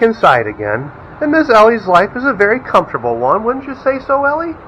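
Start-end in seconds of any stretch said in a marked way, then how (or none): 3.4-4.5 dog talk. Real, not acted. Talking sweetly to the dog.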